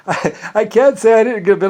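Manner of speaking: chuckling confession; laughing. Retold or imitated. Henchman admits satisfaction to boss, laughing.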